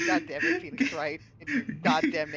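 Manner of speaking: loud fake fury at a game name; loud mock curse. Real, not acted. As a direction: shout it, half laughing. Cursing the character in laughter.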